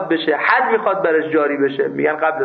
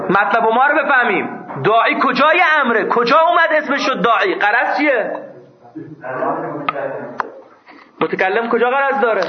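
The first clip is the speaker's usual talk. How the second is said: angry scolding; 6 semitones higher than usual. Real, not acted. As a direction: berate the class for not understanding. Teacher scolding students angrily.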